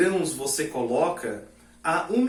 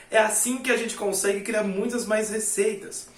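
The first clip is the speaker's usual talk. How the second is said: normal talking voice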